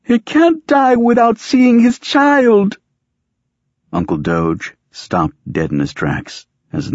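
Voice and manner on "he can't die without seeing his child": loud, slow, very high wailing; drawn-out, high grief-stricken cry. A narrator's reading. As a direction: wail it out in mourning, pitched way up. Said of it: "had the wail of grief down pat".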